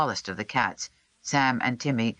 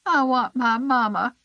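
sniffling; 12 semitones higher, 5 dB louder than usual. A narrator's reading. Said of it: "he sniffed".

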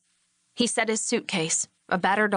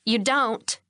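hard voice; 3 semitones higher than usual. A narrator's reading. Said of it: "his wife said in a hard voice".

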